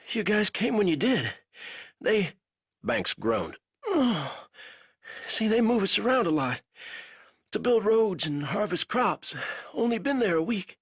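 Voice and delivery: a weak captive fumbling for words; lightly voiced delirious prisoner; feverish prisoner. A narrator's reading. Banks voice, groaning.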